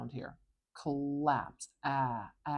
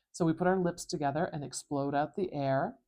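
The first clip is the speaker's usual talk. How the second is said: ordinary talk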